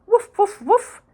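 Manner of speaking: loud high triple bark; excited little-dog barking; eager woofs. Acted. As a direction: yap three times eagerly.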